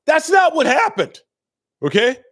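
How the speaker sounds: high, loud voice-cracking shout; furious yell. Retold or imitated. Detective yelling at Charlie in the hospital, a furious high shout. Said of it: "he just yelled".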